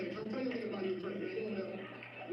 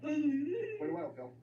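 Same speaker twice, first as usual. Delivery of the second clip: mocking slow drawl; 6 semitones higher, much slower than usual. Comedian to a mumbling audience member, slow mocking drawl. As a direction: drag out "Pretty well" very slowly and a bit higher.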